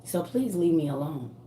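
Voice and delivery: low, soft warning plea; quiet plea. Real, not acted. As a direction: ask softly but firmly. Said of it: "I am asking you nicely".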